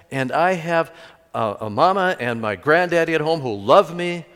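loud, high, indignant outburst; furious retort. Retold or imitated. Dwayne shouting at the praying kids, outraged. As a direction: fire back at the mission kids, offended and loud. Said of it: "Well, Dwayne was so furious".

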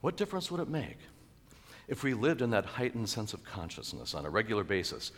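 usual speaking voice

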